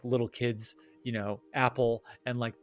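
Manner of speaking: ordinary delivery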